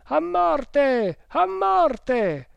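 very high, strained death cry; frenzied, piercing chant; enraged crowd shouting. A narrator's reading. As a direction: howl it like a furious mob. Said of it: "vociarono gli indiani, spumanti d'ira".

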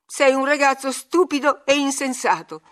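high, loud scolding; loud, exasperated outburst; furious cry. A narrator's reading. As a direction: hurl the insult, beside yourself. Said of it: "gridò Catherine fuori di sé".

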